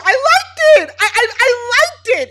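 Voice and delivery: very high, loud, stammered outburst; acted excited confession. Recapper as Cassie admitting the kiss, excited squeal. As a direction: imitate someone blurting it out at top pitch.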